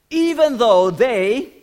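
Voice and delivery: loud, high, slow declaration; bold vow. Acted. Peter vows loyalty to Jesus, bold and loud. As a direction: proclaim it slowly at full volume.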